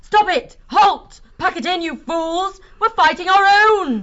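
high, loud urgent yell; frantic commander's shout; yelling at top of lungs. Acted. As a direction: scream orders to halt the fight. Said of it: "yelled at the top of her lungs".

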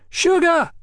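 put-on very high boyish crow. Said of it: "jumped high in the air and crowed".

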